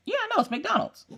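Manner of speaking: squeaky-high playful denial; mock insistence. Mom insisting the meat is McDonald's, squeaky mock innocence. Teasing insistence in retold quarrel.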